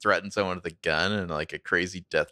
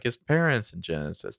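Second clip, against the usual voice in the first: ordinary delivery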